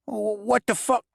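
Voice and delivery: the goblin squawking in outrage. Acted. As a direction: goblin Lennox reacting, high squeaky voice. Goblin Lennox voiced in story.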